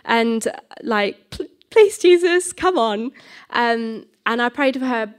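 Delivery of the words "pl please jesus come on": high, stuttered mock plea; desperate pleading. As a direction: beg urgently, acting out inner nerves. Acting out her inner desperate plea.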